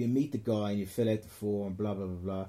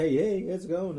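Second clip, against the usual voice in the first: bright, louder, higher fake friendliness; mock cheery greeting; 8 semitones higher, 6 dB louder than usual. Comic to the drunk heckler, mock cheery greeting. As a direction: greet her like an overkeen host. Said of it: "I said to her".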